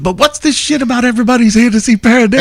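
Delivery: lightly imitating a British singer's question; British accent. Benji quoted, British singer.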